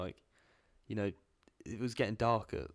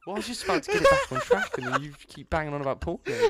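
laughing; 14 semitones higher, 8 dB louder than usual. Real, not acted. Mock scolding friend amid laughter.

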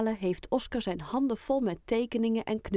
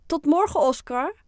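high, loud, cheerful farewell; calling goodbye; 8 semitones higher, 9 dB louder than usual. A narrator's reading. Children waving off a classmate, loud bright call. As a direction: call after a departing friend, lifting at the end. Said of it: "roepen ze".

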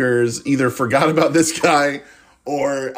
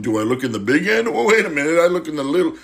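higher, hammed-up fumbling spy voice; mock confused goofball, pitched up; bumbling spy; 6 semitones higher than usual. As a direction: play a clueless spy baffled by his binoculars. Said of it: "comically figure out binoculars".

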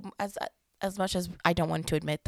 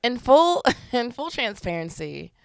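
laughing; 7 semitones higher, 10 dB louder than usual. Real, not acted. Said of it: "laugh. Get the laughs out".

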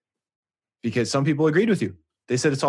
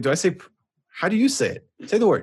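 retold demand; 8 semitones higher than usual. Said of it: "I was like".

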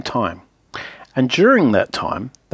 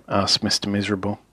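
plain reading voice

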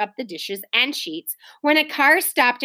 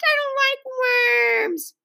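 long high whining wail; sobbing; 13 semitones higher, much slower than usual. A narrator's reading. Little guest begins to cry over cookies, wailing sobs. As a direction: stretch it into a high childish wail. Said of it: "she sobbed".

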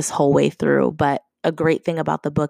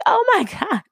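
very high retold squeal with a laugh; giddy, high-pitched imitation of astonishment; amazed delight. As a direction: act out being floored by a gift, laughing. Retold amazement at pillow gift.

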